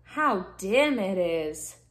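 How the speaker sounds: slow, drawn-out voiced sea anemone; murmuring anemone. A narrator's reading. Sea anemone in the dark seaweed forest, slow murmur. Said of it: "murmured the sea anemone".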